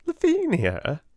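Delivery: giggling. Acted. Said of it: "with a new giggle".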